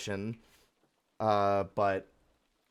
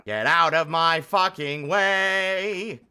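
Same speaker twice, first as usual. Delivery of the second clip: loud, high, slow yell at the game; furious yell; angry shout; 6 semitones higher, 10 dB louder, much slower than usual. Real, not acted. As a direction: bellow it at the obstacle. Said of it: "I'm actually angry".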